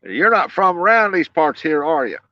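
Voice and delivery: a hint of a slow Southern local asking a stranger; local country accent. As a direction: lightly mimic a suspicious local. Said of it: "their accent's different than mine".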